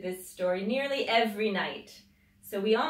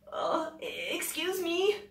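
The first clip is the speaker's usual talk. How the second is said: timid; 10 semitones higher than usual. Acted. Big Al apologising.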